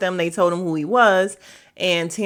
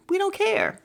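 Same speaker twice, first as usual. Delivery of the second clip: pitched way up, dismissive and airy; high dismissive; 12 semitones higher than usual. Real, not acted. Dismissing the rewatch talk, high and breezy. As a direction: toss it off high and light.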